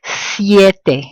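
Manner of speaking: "Siete" with the i gliding like a Y. Shown as a demonstration. Teacher showing a diphthong, "Siete" with a Y sound. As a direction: glide the ie of "Siete" together. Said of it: "That sounds like a Y".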